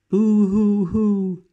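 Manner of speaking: high, loud, drawn-out boo-hoo wail; child's crying. Acted.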